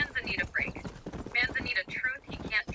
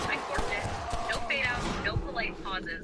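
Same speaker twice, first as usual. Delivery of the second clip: ordinary talk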